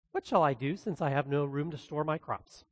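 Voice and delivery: rich man musing. Acted. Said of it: "he thought within himself, saying".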